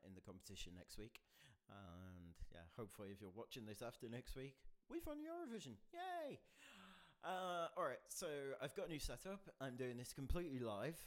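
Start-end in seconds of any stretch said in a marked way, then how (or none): 4.9-6.4 high, bright little cheer; excited cheer. Pretend. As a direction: celebrate a pretend win. Hoping the UK wins Eurovision.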